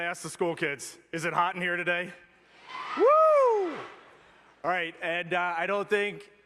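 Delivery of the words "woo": loud high whoop to the crowd; excited woo. Real, not acted. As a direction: let out a big cheering woo. Cheering with the school kids.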